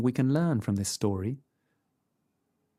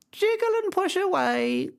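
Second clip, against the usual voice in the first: much higher croaky fake granny; croaky grandmother voice. A narrator's reading. Wolf in bed faking grandmother, high and croaky.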